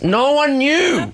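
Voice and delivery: slow, high and exasperated; exasperated drawn-out. Real, not acted. Telling cohost nobody helped, slow and exasperated. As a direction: stretch out the despair.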